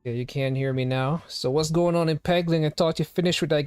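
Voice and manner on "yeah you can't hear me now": usual speaking voice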